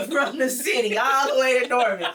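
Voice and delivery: loud, high, laughing exclamation; real laughter. Real, not acted. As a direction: laugh while telling it at full volume. Laughing at her own driving story.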